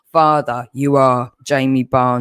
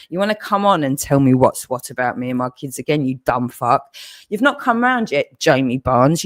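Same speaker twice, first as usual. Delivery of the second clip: ordinary delivery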